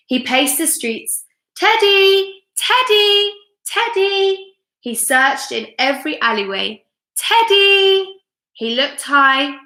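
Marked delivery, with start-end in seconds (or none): 1.6-4.6 high, loud calling. A narrator's reading. Wolf searching the streets, calling loudly for Teddy. 7.2-8.2 high, loud calling. A narrator's reading. Wolf searching the streets, calling loudly for Teddy.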